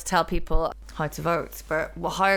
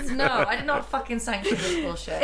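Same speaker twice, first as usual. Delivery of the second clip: high-pitched, laughing protest; mock outrage; 8 semitones higher, 3 dB louder than usual. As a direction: refuse it in comic horror. Playfully refusing the co-host's phrase.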